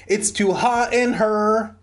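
high, drawn-out wail; hammed-up wail of complaint. A narrator's reading. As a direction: whine it slow and high. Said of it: "Brent wailed".

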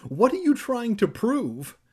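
high, loud shrieked demand. A narrator's reading. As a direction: shriek it in protest. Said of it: "Evan shrieked".

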